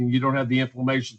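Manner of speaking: normal talking voice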